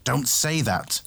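snarl. A narrator's reading. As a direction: snarl it as a sharp objection. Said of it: "Tay snarled".

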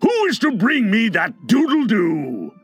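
high, furious captain's demand; thundering. A narrator's reading. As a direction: thunder the question at the crew. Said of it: "he thundered".

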